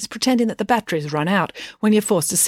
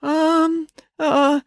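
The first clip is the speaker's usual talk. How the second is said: high, uncertain filler sounds; nervous hesitation; 11 semitones higher than usual. A narrator's reading. Dad stalls before the crowd, nervously hesitant. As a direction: stall with drawn-out ums.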